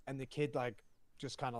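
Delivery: ordinary delivery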